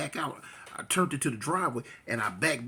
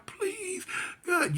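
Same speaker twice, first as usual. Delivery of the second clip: very high tearful plea; crying prayer. Retold or imitated. Girlfriend crying on the floor, high tearful prayer. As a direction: beg God through tears. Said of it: "she crying".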